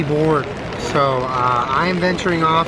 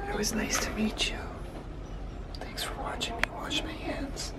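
soft high whisper; 11 semitones higher, 12 dB quieter than usual. Real, not acted. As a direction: whisper gently to the cat. Whispering in cat cafe.

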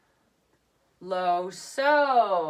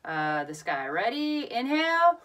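ordinary talk

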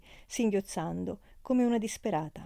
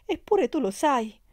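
higher, louder, pleading; loving reproach; 6 semitones higher, 6 dB louder than usual. A narrator's reading. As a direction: reproach him with love. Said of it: "in atto di amore e di rimprovero". (rimprovero affettuoso)

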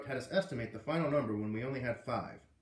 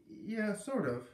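hesitant; 9 semitones higher, much slower than usual. Acted. Hesitant reply.

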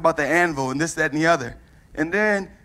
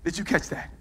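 usual speaking voice

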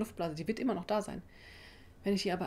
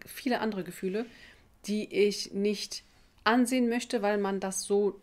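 normal talking voice

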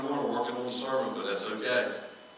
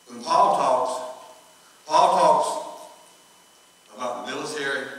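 slow, louder preaching emphasis; 6 dB louder, much slower than usual. Acted. Preacher stressing Paul's words to the congregation, slow loud preaching. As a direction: drive home each word to the congregation. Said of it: "preacher emphasising Paul".

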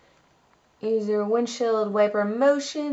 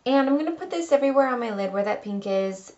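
usual speaking voice

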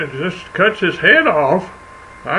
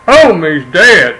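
louder shot sound, then punchline; loud boom; 11 dB louder, much slower than usual. Pretend. Hunter firing at the turkey, loud boom. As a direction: bang it out loud like a gunshot. Gunshot in the story.

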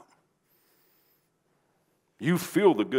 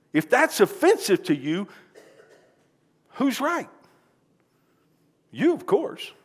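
defiant challenge; 11 semitones higher, 4 dB louder, slower than usual. Real, not acted. Preacher defiant rhetorical challenge.

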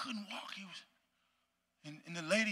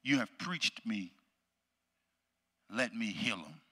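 Jesus; 7 semitones lower, slower than usual. Retold or imitated. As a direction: say it low and unhurried, with calm authority. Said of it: "this is what Jesus says".

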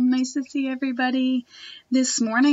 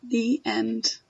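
put-on expressive read-aloud for children; reading a picture book to a class; storybook reading; 5 dB louder than usual. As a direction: read it like story time for little kids.

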